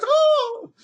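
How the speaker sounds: very high, loud shriek; startled yelp of fright; frightened cry. Retold or imitated. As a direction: jump at the sight. Wife freaks out at spider.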